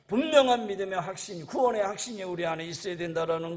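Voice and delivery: loud, driving pulpit delivery; forceful preaching. Real, not acted. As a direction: press the conviction on the congregation.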